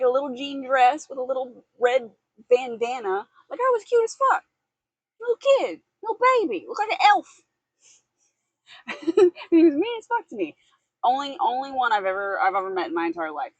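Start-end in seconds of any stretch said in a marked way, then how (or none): 3.5-9.5 put-on high, cutesy child voice; cutesy little kid voice. Voicing self as cute child. 9.5-10.5 put-on cutesy kid voice. Cute child voice continues.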